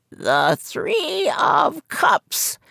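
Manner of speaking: put-on high croaky old seer's voice; croaking fortune teller. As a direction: croak it from behind a curtain. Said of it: "she said with a smile".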